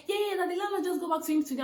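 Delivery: high, fast, hurried imitation; mock agent. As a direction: rattle off the agent's excuse.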